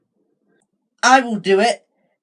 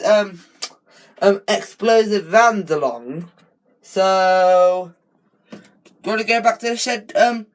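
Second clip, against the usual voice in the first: ordinary delivery, nothing marked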